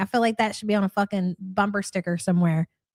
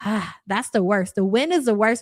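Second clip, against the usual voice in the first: usual speaking voice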